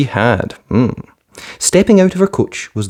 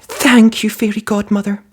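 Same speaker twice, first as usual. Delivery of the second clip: excited thanks; 12 semitones higher than usual. A narrator's reading. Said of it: "cried Cinderella".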